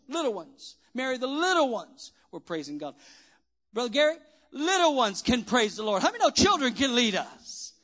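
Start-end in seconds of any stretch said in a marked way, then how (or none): none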